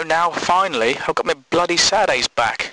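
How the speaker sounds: normal talking voice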